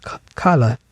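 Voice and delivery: British accent, final R dropped. Shown as a demonstration. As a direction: say "color" the British way. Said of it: "The English people say different".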